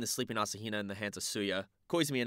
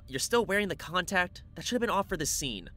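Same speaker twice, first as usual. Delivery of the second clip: put-on bossy girl voice; 9 semitones higher, 3 dB louder than usual. Haruhi voiced.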